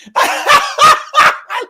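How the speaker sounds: loud, high helpless laugh; real belly laugh, cracking up; high, loud howl of laughter; big laugh. Real, not acted. Loses it after chat line.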